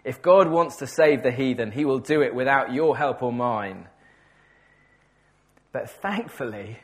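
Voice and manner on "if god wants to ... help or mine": loud, sharp "Sit down" then firm dismissal; stern rebuke. Retold or imitated. Senior pastor silencing young Carey at the meeting, loud stern rebuke. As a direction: bark the pastor's put-down at the young man. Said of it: "a senior pastor told him".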